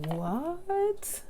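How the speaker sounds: high incredulous what; surprised what. Real, not acted. Hearing the name pearl diving, high surprised what. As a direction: blurt it out in disbelief.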